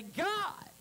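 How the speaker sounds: high, loud plea to God; crying out to heaven. Acted. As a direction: call out urgently to heaven. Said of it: "change the tone of your coo".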